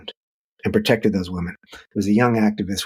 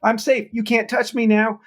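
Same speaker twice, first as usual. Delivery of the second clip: put-on much higher, childlike tag-game taunt; child playing tag; 12 semitones higher than usual. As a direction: tease like a kid safe on base. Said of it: "like when you're playing tag".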